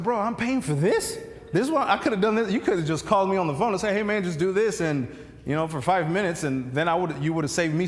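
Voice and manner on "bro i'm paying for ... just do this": higher-pitched, quick mock-indignant complaint; incredulous protest. Patient objecting to paying the chiropractor for this, incredulous protest. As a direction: protest like a short-changed customer.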